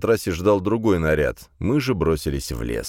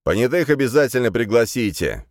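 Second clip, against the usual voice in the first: raised-pitch order called out; calling after them; 6 semitones higher, 3 dB louder than usual. A narrator's reading. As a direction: call it out after departing men. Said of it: "кликнул вслед старший".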